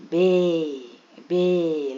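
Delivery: बे with its vowel pulled long; drawn-out long A. Shown as a demonstration. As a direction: stretch बे out on a falling tune. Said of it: "लंबा खींचे".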